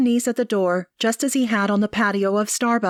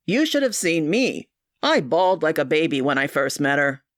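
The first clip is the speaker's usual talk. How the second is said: a hint of a lower man's voice, amused; lightly voiced brother; 4 semitones lower than usual. A narrator's reading. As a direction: narrator leaning lightly into the brother. Gerard voiced by narrator.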